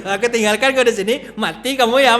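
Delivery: a heartless husband brushing off his wife, hammed-up cold; heartless parting words. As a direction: toss off the goodbye like you don't care. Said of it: "Itu nggak ada hati".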